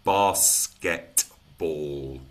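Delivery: basketball in three slow, separate beats; syllable by syllable. Shown as a demonstration. Teacher counting syllables for trainees, very slowly syllable by syllable. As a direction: stretch out BAS-KET-BALL, low and even. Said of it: "Three syllables".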